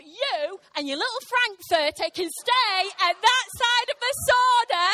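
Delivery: loud, high, hammed-up indignation; retold fury at a stranger, played big; angry defiance. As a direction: stand your ground and shout it. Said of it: "I was angry".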